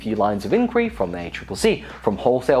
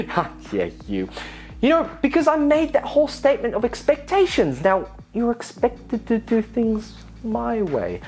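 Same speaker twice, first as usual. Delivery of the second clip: mock indignation; 7 semitones higher than usual. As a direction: act wounded and superior.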